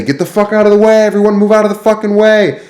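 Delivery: very high, loud furious bark; angry cook. Retold or imitated. Rude cook clearing the kitchen, very high furious bark. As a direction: bellow everyone out of the way. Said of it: "He had to say".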